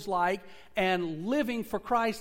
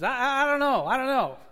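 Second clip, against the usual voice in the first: flustered stammer; 7 semitones higher, 9 dB louder than usual. Retold or imitated. Mom caught off guard.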